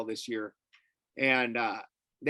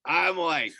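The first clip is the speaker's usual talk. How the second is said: usual speaking voice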